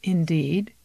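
plain reading voice

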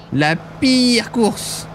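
very slow, dragged-out complaint; sulky drawl. Real, not acted. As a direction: drag it out, sulking. Sulking about the race. (plainte traînante et boudeuse)

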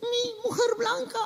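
very high falsetto in pidgin Spanish; falsetto foreign woman. Acted. Rescued stewardess thanks the clown, falsetto. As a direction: squeak out gratitude as a comic foreign damsel.

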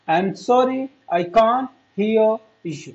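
slow, careful English to repeat; teacher models the phrase for students, slow and clear. Shown as a demonstration. Model each word slowly for the class. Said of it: "मेरे पीछे बोले तभी तो practice होगी".

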